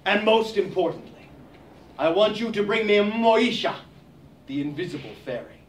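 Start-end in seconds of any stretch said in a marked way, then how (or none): 4.5-5.6 soft and low, lightly mysterious; hushed mysterious. Acted. To children, the invisible fairy said hushed and mysterious. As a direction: breathe it like a secret.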